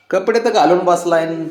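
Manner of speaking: usual speaking voice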